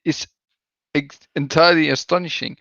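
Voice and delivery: ordinary talk, nothing marked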